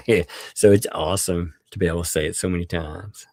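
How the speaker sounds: normal talking voice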